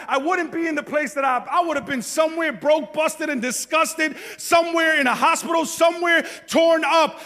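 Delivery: loud, high, driving sermon climb with shouted peaks; full-force preaching. Acted. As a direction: preach it to the rafters, building fervor.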